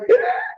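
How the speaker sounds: high mock scream of terrified children; terrified kids' squeal. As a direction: squeal like a child scared on a ride. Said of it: "they were like".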